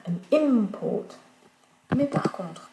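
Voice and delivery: usual speaking voice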